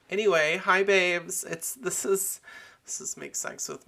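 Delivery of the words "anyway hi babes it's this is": high, loud hi babes; excited hello to listeners; bright greeting. Pretend. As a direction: burst back in cheerily.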